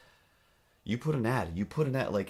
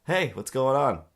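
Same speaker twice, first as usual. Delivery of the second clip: high, loud startled greeting; the caller suddenly cheerful; surprised caller; 7 semitones higher, 7 dB louder than usual. Retold or imitated. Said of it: "And then he said".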